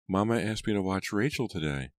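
a hint of a young daughter's voice; little girl. A narrator's reading. Abigail explains her chore to Papa, slightly childlike.

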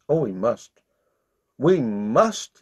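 ordinary delivery, nothing marked

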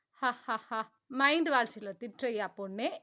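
plain reading voice

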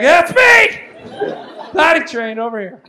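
high, loud, laughing proud shout. Retold or imitated. Brother-in-law shouting proudly at the show, high and loud. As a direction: claim it proudly for everyone to hear. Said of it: "He's like".

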